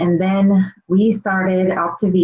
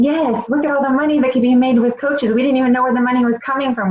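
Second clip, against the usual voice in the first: fast, higher retold excitement; excited; 5 semitones higher, faster than usual. Recalling money rolling in, fast and excited. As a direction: rush it out, amazed at the money.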